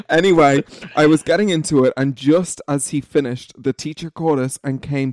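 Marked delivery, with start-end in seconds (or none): none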